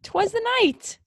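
very high, put-on voice; high-pitched title echo.

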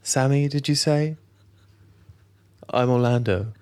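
lightly voiced celebrity guest; half-hearted impression of a film actor; a hint of a put-on star voice; Orlando Bloom. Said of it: "heard Orlando Bloom speak like that".

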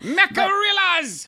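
MECHARILLAS cried out very high; high, outraged exclamation; indignant outcry. Acted. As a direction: squawk the word in protest. Cries out the word in protest.

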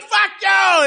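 driveway shouting match, mock yell; retold neighbor shout. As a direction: imitate the neighbor yelling. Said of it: "And then they're like".

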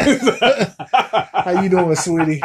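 slow, drawn-out mock flirting; flirty sweet talk.

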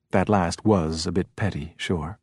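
ordinary delivery, nothing marked

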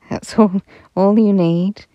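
laughing. Acted. Angels laughing, giving money.

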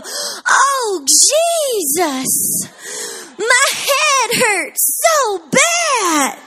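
high, loud, pained moaning cry of a hurting grandma; parrot imitating Nana's migraine. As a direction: wail it like a parrot copying an old lady's headache. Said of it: "I'd hear this voice go".

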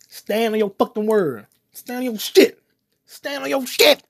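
building from firm and slow to a high, loud yell; rising rant. Real, not acted. Urging viewers to stand on their word, building to a shout. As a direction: drive it home harder each time. Ranting louder and louder.

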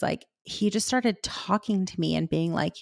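normal talking voice

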